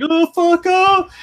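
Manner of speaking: high, drawn-out comic scream; hammed-up horror-victim howl; mock agonized yell. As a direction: wail it in exaggerated pain. Acts kid whose arm is ripped off.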